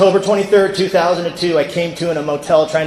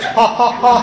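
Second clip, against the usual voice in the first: high staccato mock laugh; three separate, put-on ha's, pitched up; imitated laugh; 4 semitones higher, slower than usual. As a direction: act out a buddy's canned laugh. Jack's laugh in the story.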